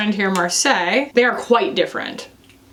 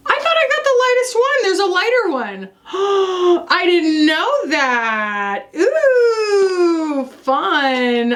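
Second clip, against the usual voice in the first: surprised excitement; 9 semitones higher, slower than usual. Real, not acted. Surprise at a lighter shade.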